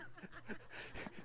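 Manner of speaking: a real helpless laugh. Real, not acted. Preacher laughs at the story.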